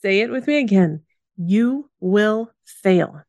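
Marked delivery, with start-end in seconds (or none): 1.4-3.2 very slow, each word apart; slow mantra. Real, not acted. Podcaster leading listeners in repeat, slow mantra. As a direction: spell it out word by word.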